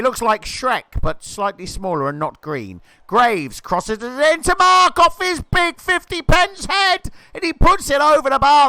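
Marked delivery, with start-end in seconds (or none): 3.1-8.7 louder and higher as the header flies over; rising thrill that spills over the bar; excited build-up. Real, not acted. Commentator calling a header chance, excited and loud.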